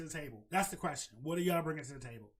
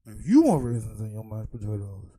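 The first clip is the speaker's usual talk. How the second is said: much louder, shouted; loud punchline; 8 semitones lower, 10 dB louder than usual. Real, not acted. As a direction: slam the punchline home.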